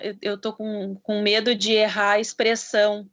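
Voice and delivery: ordinary talk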